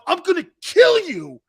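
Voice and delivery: high, loud enraged threat; furious threat. Retold or imitated. Still raging at the opposing lineman, furious threat. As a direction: scream the threat again. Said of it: "And I'm sitting there going".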